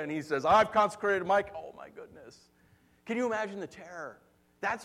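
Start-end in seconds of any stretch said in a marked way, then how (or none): 0.4-1.5 loud, commanding divine declaration; God's booming voice. Retold or imitated. God speaks to rebelling kings, booming declaration. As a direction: thunder it over the rebels. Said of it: "God speaks to them".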